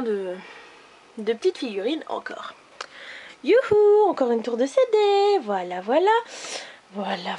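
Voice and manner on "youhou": high, loud ironic whoop; mock cheer. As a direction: cheer sarcastically at the mess. Ironic cheer over more CDs. (youhou ironique, aigu et fort)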